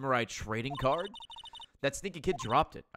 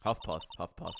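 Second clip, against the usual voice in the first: out of breath; 7 semitones lower, 4 dB quieter than usual. Acted. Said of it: "She's out of breath, dude".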